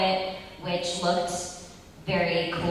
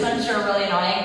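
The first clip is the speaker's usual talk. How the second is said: ordinary talk, nothing marked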